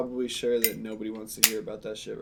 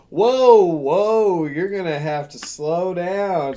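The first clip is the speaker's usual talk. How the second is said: loud, high, drawn-out "whoa"; mock-overwhelmed phone lady, pitched up and stretched; imitating alarmed interruption; 6 semitones higher, 11 dB louder, much slower than usual. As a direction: cut in loudly to halt a caller. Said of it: "And this lady goes".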